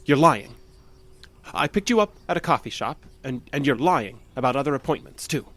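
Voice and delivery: loud, agitated accusation; angry accusation. Acted. Jeff shouting at the doctor he's lying. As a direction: throw the accusation at him.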